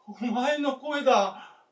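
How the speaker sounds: loud, slow cry of joy; crying out loud, slowly, in joy; overwhelmed cry. Acted. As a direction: let it burst out slowly. Old man moved to hear her.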